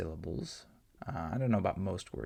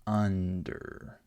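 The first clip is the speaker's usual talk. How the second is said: "under" with a full, louder UN; stress on first syllable; 4 dB louder than usual. Shown as a demonstration. Teacher models a stressed short U for learners, first syllable stressed in "under". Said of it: "this is the stressed syllable".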